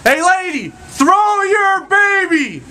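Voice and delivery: high, very slow, stretched shout; goalkeeper yelling up. Acted. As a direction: call up to the roof, long and drawn out. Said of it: "he yells up".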